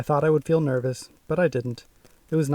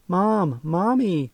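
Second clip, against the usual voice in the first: higher mock scream for mom; 4 semitones higher, much slower than usual. A narrator's reading. Scared girl calling for her mom, lightly screamed. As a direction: call out for mom, frightened but restrained. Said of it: "I screamed".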